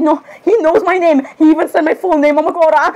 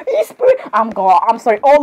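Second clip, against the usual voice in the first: ordinary talk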